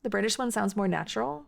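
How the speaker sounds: "natural" lifted at the end as a question; automatic, unforced question tune rising on "natural"; instinctive question intonation. Shown as a demonstration. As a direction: ask it with a gradual rise into "natural". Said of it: "the first one was my instinctive auto way of saying it".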